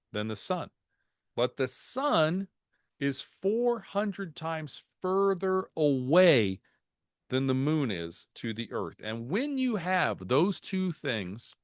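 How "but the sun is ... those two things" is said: slow dramatic build. Acted. Building up the eclipse fact.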